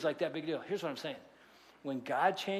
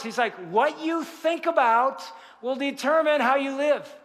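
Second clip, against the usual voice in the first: louder, higher, emphatic sermon voice; raised-voice pulpit exhortation; forceful preaching; 6 semitones higher, 6 dB louder than usual. Acted. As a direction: preach it loudly, driving each point home.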